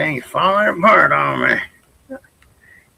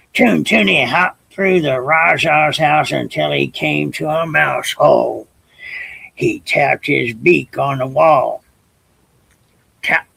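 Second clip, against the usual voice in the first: usual speaking voice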